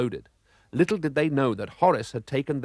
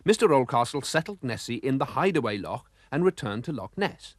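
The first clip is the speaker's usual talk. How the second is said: usual speaking voice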